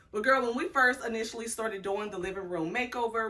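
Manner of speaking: ordinary talk, nothing marked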